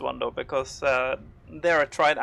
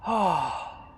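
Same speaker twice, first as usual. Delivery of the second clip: ordinary talk, nothing marked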